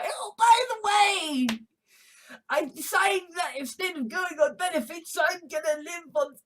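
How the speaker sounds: put-on high-pitched announcement; mock announcement. Acted announcement, raised voice.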